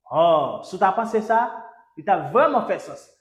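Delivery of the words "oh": normal talking voice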